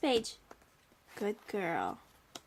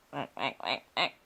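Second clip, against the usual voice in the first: low, short duck quacks; low-pitched play duck noises; duck quacking; 12 semitones lower than usual. Acted. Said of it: "What did the duck say?".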